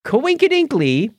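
Coinkedinkly sung out high and silly; playful jokey word. Real, not acted. Podcast host to cohost, a high, silly Coinkedinkly. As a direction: toss off "Coinkedinkly" as a high, goofy joke.

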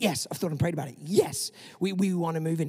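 mock-eager reply to a generous offer; eager yes. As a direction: blurt it out eagerly. Eager instant yes on phone call.

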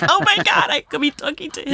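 very high, giddy retold excitement; starstruck squeal. Fan recalling talking to the artist, giddy squeal. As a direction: relive the fangirl thrill. Retelling her starstruck reaction.

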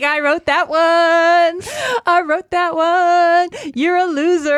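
high, loud taunting gloat. Real, not acted. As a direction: rub the win in. Gloating after fooling her.

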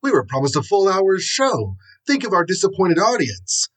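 high-pitched, indignant protest; angry TV producer. A narrator's reading. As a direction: complain like an outraged producer. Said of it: "running forward angrily".

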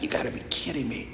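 hushed, quick, voiceless aside; retold incredulous mutter under the breath; muttered disbelief. Retold reaction to three-hour podcast.

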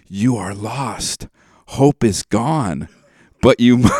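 mock-tragic slow recital; dramatic lyric recitation. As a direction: intone the bleak line like a eulogy. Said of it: "the line is".

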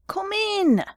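very high, piercing squeal; shrill cry. A narrator's reading. Boss answering a knock on his office door, shrill. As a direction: cry it out high, falling at the end. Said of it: "The shrill voice cried".

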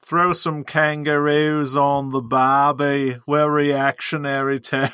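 slow, hammed-up Liverpool-Aussie mix; a weird Liverpool-Australian blend, slowly; Liverpudlian Australian accent. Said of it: "it's like a Liverpudlian Australian".

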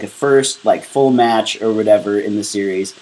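ordinary talk, nothing marked

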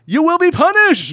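very high, loud mock threat; hammed-up shriek of vengeance; imitated yell. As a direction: yell it like a man being dragged away. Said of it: "he yells".

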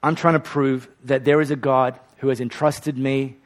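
usual speaking voice

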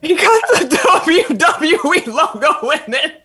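high, drawn-out amused reveal; laughing. Real, not acted. Telling co-hosts about the profile picture, laughing.

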